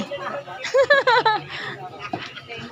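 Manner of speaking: high laugh, softly dying away; real burst of giggles that tails off. Real, not acted. Joking with a passing friend. (matinis na hagikhik)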